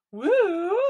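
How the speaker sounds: mock cheer. Cheering about getting bus pass.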